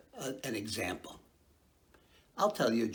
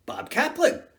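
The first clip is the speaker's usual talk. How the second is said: punchline; 13 semitones higher, 4 dB louder than usual. Acted. Knock-knock punchline.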